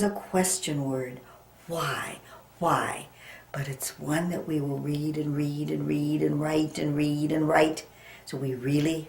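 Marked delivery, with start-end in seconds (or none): 1.7-2.9 breathy hush on a single word; whispered why. Shown as a demonstration. Teacher whispering the word to the class. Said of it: "teacher whispers the word". 3.6-7.8 fast whisper. Shown as a demonstration. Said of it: "teacher rattles off repetition".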